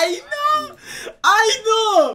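high, loud excited yell; excited shout. Real, not acted. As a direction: shout it out, thrilled to tease. Teasing shout at caller. (opgewonden hoge schreeuw)

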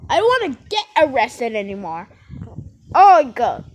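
loud, high groans and protest; acted exasperation. Suspect fed up with being arrested, acted loud exasperation. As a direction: protest loudly with hammed-up annoyance.

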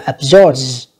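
absorbs ending in a buzzing z. Shown as a demonstration. Teacher showing the final s of absorbs as z. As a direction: end absorbs on a voiced z. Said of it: "âm giờ ha các bạn".

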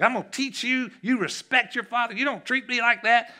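father yelling at his disrespectful son; furious retelling. Retold or imitated. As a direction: bark it at the son down the hallway. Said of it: "I was mad".

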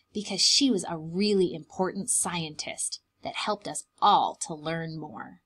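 ordinary delivery

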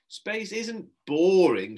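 slow, high, firm correction; firm slow rebuke; firm reply. A narrator's reading. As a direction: set the boy straight. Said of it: "she replied firmly".

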